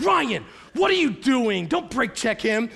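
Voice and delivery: loud, high mock yell with drawn-out words; wife's alarmed scolding. Wife scolds husband for brake checking, exasperated mock yell. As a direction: imitate an exasperated wife yelling at the driver.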